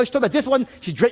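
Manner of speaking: ordinary delivery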